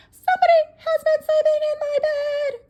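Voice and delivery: put-on very high, loud baby bear squeal; wee little voice. Baby bear finds his bed slept in, shrill wee voice. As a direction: cry it out shrilly as a tiny bear. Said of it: "cried the baby bear in his wee little voice".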